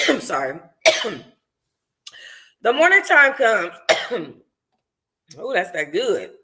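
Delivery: plain reading voice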